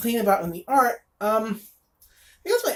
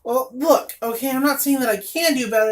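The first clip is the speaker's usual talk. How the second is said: normal talking voice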